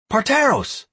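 high, sharp call; shouted name. A narrator's reading. Leader hailing another clan's chief, shouted. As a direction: call out to someone across the crowd. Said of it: "I shouted to the leader of some other clan".

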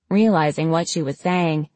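ordinary delivery

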